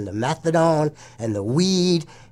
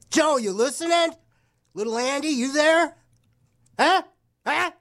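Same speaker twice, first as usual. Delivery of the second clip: high-pitched call out to the sponsors; 10 semitones higher than usual. Real, not acted. DJ calls out to the store staff, high playful hail. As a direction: pitch it way up, calling to someone in the back.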